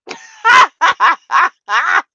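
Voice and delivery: a big, high burst of laughter; loud laugh. Real, not acted. Laughing out loud at the murder story she mocks. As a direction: crack up loudly at the joke. Laughing at her own joke.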